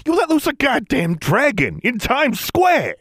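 very high, slow, outraged yell; furious shout. Acted. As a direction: scream the accusation. Johnson furious at governor.

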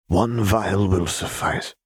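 slow, clinical agreement; measured Singed. A narrator's reading. Singed agreeing to Jinx's terms, slow and measured. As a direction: draw it out like a deliberate scientist.

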